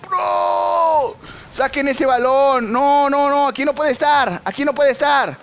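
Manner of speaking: high, loud pleading to teammates; real alarmed match yelling; urgent shouting. Real, not acted. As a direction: open on a long yell, then urge them to clear it. Orders team to clear the ball.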